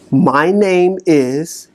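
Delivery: slow, deliberate self-introduction; slow declaration. Shown as a demonstration. Coach modelling a slow name declaration for listeners. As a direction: say it slowly and firmly. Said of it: "I want you to say".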